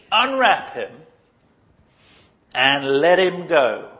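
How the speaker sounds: commanding. Acted. Jesus ordering the crowd to free Lazarus, commanding. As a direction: give the order to the onlookers.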